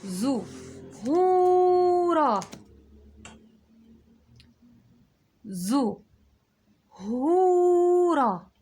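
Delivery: زهورة very slow, high and loud, the oo held long; long vowel. Shown as a demonstration. Teacher stretching the long oo for kids. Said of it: "هو طولت هنا".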